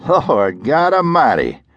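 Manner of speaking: a lightly voiced sheriff recoiling at the smell; disgusted sheriff. Sheriff entering the reeking room, mildly disgusted. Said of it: "the sheriff said, waving a hand".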